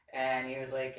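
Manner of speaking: usual speaking voice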